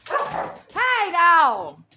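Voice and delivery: loud, sharp command to the dogs; sharp shout at dogs. Real, not acted. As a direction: bark it out to stop them. Yelling at the dogs to stop.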